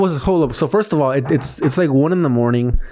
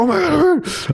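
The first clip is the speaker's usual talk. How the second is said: mock panic; 14 semitones higher, much slower than usual. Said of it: "I panicked again so much".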